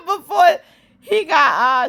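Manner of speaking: high, giggling words; laughing through words. Real, not acted. Vlogger cracking up recapping the scene. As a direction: laugh while trying to talk.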